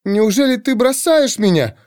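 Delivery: high, plaintive woman's voice; tremulous, high-pitched hurt question; trembling voice. A narrator's reading. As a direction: plead as if being abandoned. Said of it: "повторила она дрожащим голосом".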